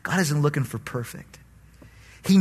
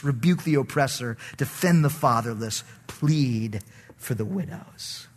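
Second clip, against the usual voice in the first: usual speaking voice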